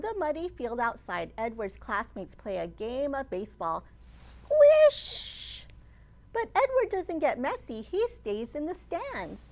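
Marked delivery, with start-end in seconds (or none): 4.1-5.6 high, loud squish; squishy sound. A narrator's reading. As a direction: squeal the squish. Mud sound word.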